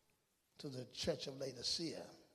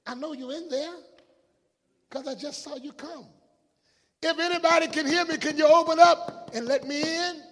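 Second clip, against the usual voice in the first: high-pitched call building to a shout; calling through the door; 12 semitones higher, 15 dB louder than usual. Acted. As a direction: demand to be let in, getting louder. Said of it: "He's knocking on a door".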